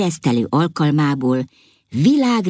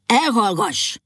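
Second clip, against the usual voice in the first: a mildly raised, sharp command to be silent; angry shout; 6 semitones higher, slower than usual. A narrator's reading. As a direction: silence her with a touch of anger. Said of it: "kiáltotta dühösen". (dühös kiáltás, visszafogottan)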